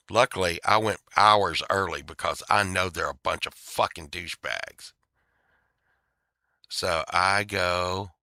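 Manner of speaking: ordinary delivery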